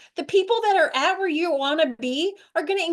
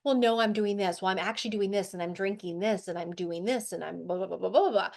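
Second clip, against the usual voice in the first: mock defensive rambling; 5 semitones lower, 4 dB quieter than usual. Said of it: "our natural knee-jerk reaction is to defend".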